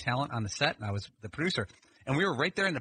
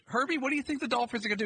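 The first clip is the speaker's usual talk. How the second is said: higher, quicker on-air question; broadcast host; 12 semitones higher, faster than usual. Retold or imitated. Co-host tossing a draft question to the analyst, mimicked host voice. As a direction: pitch it up like a host throwing to an analyst.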